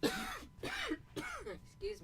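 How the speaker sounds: coughing, throat itching; dry cough. Real, not acted. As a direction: clear a scratchy throat. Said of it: "now my throat is itching".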